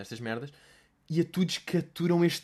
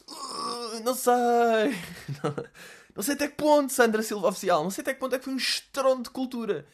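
mock incredulous; 9 semitones higher than usual. Mocking the post's claim.

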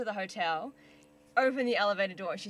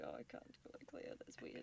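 much softer, unvoiced, to herself; whispered thought; 17 dB quieter than usual. Kid decides what to call the actress, whispering. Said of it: "I was like".

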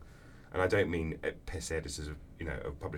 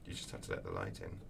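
a much lower, softer hint of a wise old teacher; mentor's voice; 10 semitones lower, 8 dB quieter than usual. David gives his depressed friend advice, lower and softer. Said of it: "And he said".

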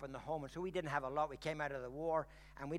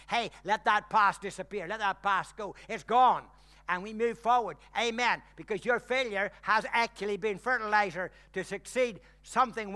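loud, high, fervent preaching; full-force preaching; 5 semitones higher, 11 dB louder than usual. Acted. Pastor rousing the church to let the past go, fervent preaching. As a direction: rouse the congregation, louder and higher.

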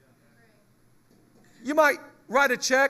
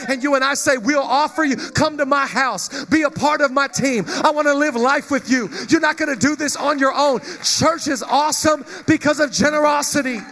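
loud, driving sermon delivery; full-force preaching; 4 semitones higher, 6 dB louder than usual. Acted. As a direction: preach it out to the congregation.

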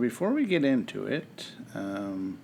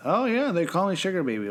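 higher, louder mock-playful swagger; exaggerated singsong put-on boast; mocking imitation; 8 semitones higher, 5 dB louder than usual. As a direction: parody a friend's unconvincing act. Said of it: "played it like someone who".

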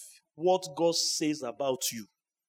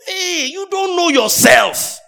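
loud, high, slow shout; a preacher's booming rebuke; 12 semitones higher, 11 dB louder, slower than usual. Real, not acted. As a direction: bellow it at the congregation.

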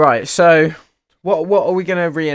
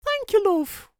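high woman voice. Acted. Reenacted skit, woman character.

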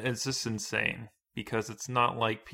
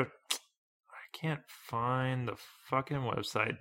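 whispering; 2 semitones higher, 6 dB quieter, slower than usual. Real, not acted. Muttering while searching online.

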